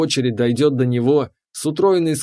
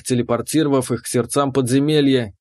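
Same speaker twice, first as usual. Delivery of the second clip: plain reading voice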